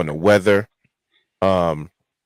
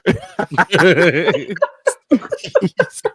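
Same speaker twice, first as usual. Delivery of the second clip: a big real burst of laughter, pitched up; loud laugh; 9 semitones higher, 4 dB louder than usual. Real, not acted. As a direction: crack up out loud at the story. Reacting to middle finger story.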